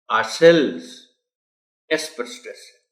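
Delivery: ordinary delivery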